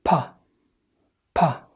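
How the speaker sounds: short "با" pronounced pa; "با" with a puffed p in place of b; English P sound. Shown as a demonstration. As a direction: model "با" as English p plus short a. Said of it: "ننطق صح".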